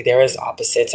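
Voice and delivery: usual speaking voice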